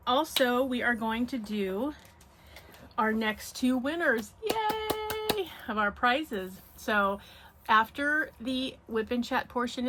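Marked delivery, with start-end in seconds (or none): 4.4-5.5 high little yay of delight; excited cheer. Real, not acted. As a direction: cheer it brightly. Cheering the upcoming prize winners.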